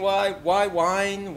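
ordinary talk, nothing marked

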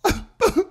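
high hammed-up sob; a fake teary squeal; mock sob laugh. Mocking [name]'s tears at the rally, high mock sob. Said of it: "She's all".

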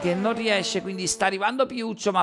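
fast race commentary, rising with the action; live play-by-play. Real, not acted. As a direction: call the qualifying lap live.

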